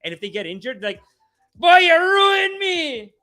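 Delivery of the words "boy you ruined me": put-on high, slow, loud West Indian accent wail; yelling West Indian coach. Coach yelling at an injured kid, West Indian accent. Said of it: "he's just yelling at the kid".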